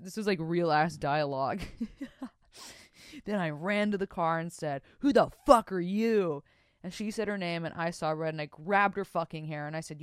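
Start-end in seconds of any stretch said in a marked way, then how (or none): 5.0-6.4 loud, punched-up indignant demand; acted confrontation. Host re-reads the car confrontation, loud and outraged. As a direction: belt it out at the rival.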